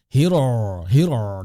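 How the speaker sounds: Hiro said with a throaty guttural r; ro from the throat. Shown as a demonstration. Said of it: "mengucapkan ro-nya itu dari tenggorokan". (ro dari tenggorokan)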